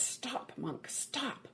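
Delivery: ordinary talk, nothing marked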